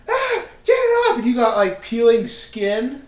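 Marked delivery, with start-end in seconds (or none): 0.1-1.2 high, laughing mock panic; hammed-up yelp of disgust. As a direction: squeal it as if something gross is on your face. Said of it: "Can you imagine you going".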